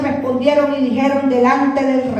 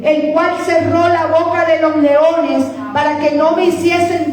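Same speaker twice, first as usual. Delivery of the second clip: higher, ringing, declaimed testimony; Daniel answering triumphantly; 4 semitones higher than usual. Acted. Daniel answers the king from the lions' den, triumphant proclamation. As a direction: proclaim innocence at a raised pitch.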